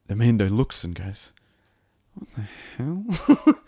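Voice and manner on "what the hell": high, louder, drawn-out incredulity; startled disbelief. Acted. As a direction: draw it out in astonished disbelief. Acts out character's startled reaction.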